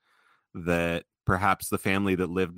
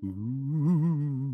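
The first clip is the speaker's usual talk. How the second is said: high, drawn-out ghostly ooh; spooky ghost voice; 8 semitones higher than usual. Pretend. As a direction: moan it like a cartoon ghost. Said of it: "I need a better spooky ghost voice".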